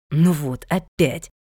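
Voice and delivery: a touch of weary annoyance; understated exasperated grumble; irritated. A narrator's reading. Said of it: "раздражённо сказал он".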